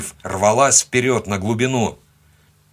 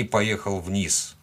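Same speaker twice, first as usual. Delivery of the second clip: ordinary delivery, nothing marked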